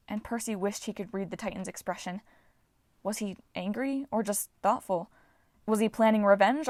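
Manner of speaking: ordinary delivery, nothing marked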